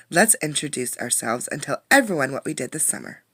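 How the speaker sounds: put-on lively read-aloud for young kids; story reading. A narrator's reading. A teacher reading a storybook to her class, lively. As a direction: bring the picture book to life.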